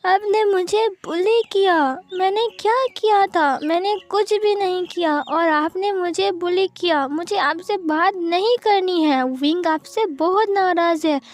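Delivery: put-on high, slow, hurt little boy; crying child voice. Five-year-old Wing scolding Wang Ji, high slow tearful child voice. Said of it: "wing ने कहा".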